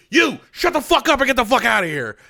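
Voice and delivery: loud, high retold yell at her to get lost; barking her off in a put-on angry yell; mock angry yell. Tojima yelling her out of the room, loud and furious.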